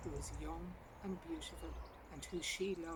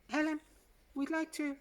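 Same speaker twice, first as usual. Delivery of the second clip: higher, louder receptionist calling her back; 10 semitones higher, 8 dB louder than usual. A narrator's reading. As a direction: call her name to stop her hanging up. Receptionist calls after her.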